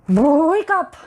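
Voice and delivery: a lightly voiced boy calling to wake him; mysterious boy. The mysterious boy calls Basil awake, high and loud.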